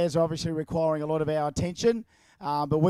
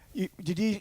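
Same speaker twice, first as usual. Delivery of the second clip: ordinary delivery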